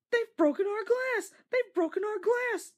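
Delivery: very high, loud wailing lament; sobbing coaches. A narrator's reading. Coaches crying over broken windows, high loud wail. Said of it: "sobbed the coaches".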